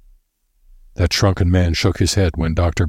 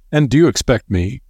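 higher, amused disbelief; smiling scorn; 7 semitones higher, 3 dB louder than usual. A narrator's reading. Sir Douglas mocks Bevan's warning, smiling and higher. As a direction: smile through the question, mocking. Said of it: "smiled Sir Douglas".

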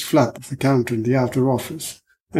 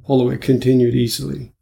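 ordinary delivery, nothing marked

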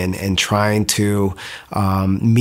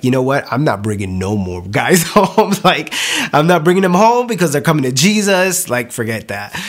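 mock exasperation; 5 semitones higher than usual. Joking about revival at home.

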